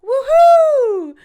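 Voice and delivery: loud high whoop of joy; excited cheer. Shown as a demonstration. Celebrating good news with a loud joyful whoop. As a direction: cheer it out like you just won.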